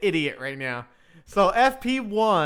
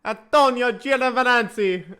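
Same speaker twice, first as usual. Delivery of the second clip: mock Italian name, higher, breaking into laughter; hammed-up Italian accent; 5 semitones higher, 4 dB louder than usual. Shown as a demonstration. Streamer trying a driver's name to offend Italians, mock Italian accent. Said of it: "I'll try to say it and offend every Italian".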